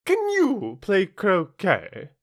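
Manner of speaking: high, slow, imperious question; shouting Queen. A narrator's reading. Queen quizzing Alice about croquet, high shout. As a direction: call it out high, stretching the words. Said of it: "shouted the Queen".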